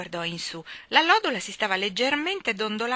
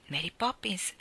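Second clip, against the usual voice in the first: usual speaking voice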